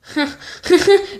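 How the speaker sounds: a brief burst of chuckles; laugh. Real, not acted. Host inviting viewers to laugh along, short chuckle.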